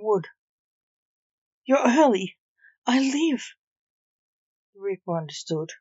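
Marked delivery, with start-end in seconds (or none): 1.6-3.4 higher and louder in relief; triumphant, elated shout; triumphant cry. Acted. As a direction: crow it like a reprieved prisoner. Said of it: "Vivienne cried triumphantly".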